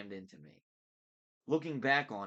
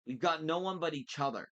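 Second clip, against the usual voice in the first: higher, louder, emotional plea; voice breaking a little; 6 semitones higher, 5 dB louder than usual. A narrator's reading. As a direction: plead with a touch of heartbreak. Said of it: "her voice broke a little".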